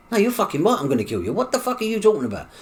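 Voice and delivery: high, loud acted outburst; angry threat. Son threatening the man in the hospital bed, loud and angry. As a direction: act out a furious challenge.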